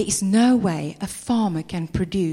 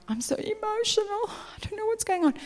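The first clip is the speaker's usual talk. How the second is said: overwhelmed believer; 12 semitones higher, 6 dB quieter than usual. Retold or imitated.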